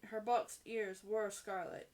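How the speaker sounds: ordinary delivery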